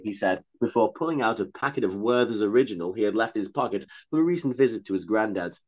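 usual speaking voice

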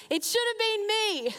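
high, sudden cry of despair; frustrated yell. Retold or imitated. Grieving widow on the beach crying out, a raw high yell. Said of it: "I just yelled out in a moment of frustration".

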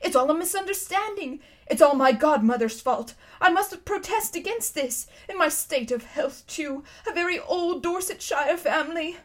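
high, self-pitying lament; wailing. A narrator's reading. Uncle Andrew protesting in the darkness, wailing. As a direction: wail in protest like a man at his wits' end. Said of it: "it was wailing on".

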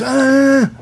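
high, strained wordless cry through a tube; a choked, desperate "ah" from a man who can't talk; strangled cry. Retold or imitated. Patient with a breathing tube trying to cry out, strained high cry. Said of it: "And he goes".

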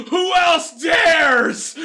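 high, slow booming challenge; lizard roar. Retold or imitated. The lizard dares the crowd after killing the drow, booming and slow. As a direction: draw it out like a triumphant monster. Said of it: "rips out the drow's neck and goes".

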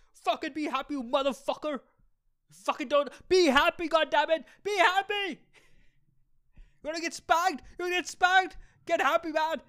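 high, loud hammed-up parental rage; mock furious yelling. Joking father yelling at his future kid to be happy, hammed-up loud rage. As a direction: bellow it like a cartoon angry dad. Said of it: "I'll be like".

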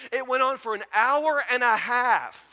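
normal talking voice

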